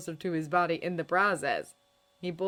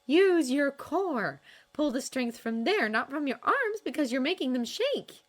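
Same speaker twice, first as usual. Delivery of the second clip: Astrid coaching; 9 semitones higher, 4 dB louder than usual. A narrator's reading. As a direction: teach him calmly but with authority.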